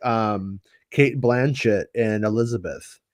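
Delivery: ordinary talk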